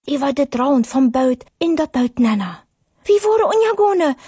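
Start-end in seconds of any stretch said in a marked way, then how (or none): 3.1-4.2 high frightened shout, rising to a fall; pitched-up desperate yell; panicked cry. Acted. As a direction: cry out in terror as the boat sinks. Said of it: "schrie eine von den Männern".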